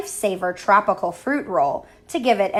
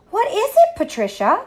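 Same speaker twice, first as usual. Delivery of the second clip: high, loud startled call; sudden high cry of fright; 11 semitones higher, 4 dB louder than usual. A narrator's reading. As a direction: cry out the question in alarm. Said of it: "Harriet cried".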